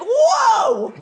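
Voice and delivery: high, loud startled yelp; sudden loud surprise; startled whoa. Retold or imitated. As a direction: jump back at the accusation. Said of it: "And Matthew McConaughey's like".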